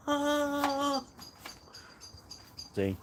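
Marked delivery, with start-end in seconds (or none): 0.1-1.0 high mock shark scream. Vlogger repeating the Jaws impression, screeching high.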